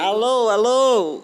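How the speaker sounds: slow, drawn-out mic check; hidden mic. Pretend. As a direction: call slowly into the hidden mic. Testing a microphone.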